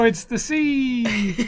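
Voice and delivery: drawn-out punchline. Pretend. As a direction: stretch out the punchline. Delivering joke punchline.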